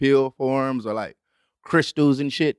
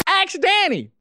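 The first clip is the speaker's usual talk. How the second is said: high, announcer-style segment call; show announcer; slower than usual. Acted. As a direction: shout the segment title like a jingle.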